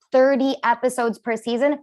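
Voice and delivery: ordinary talk, nothing marked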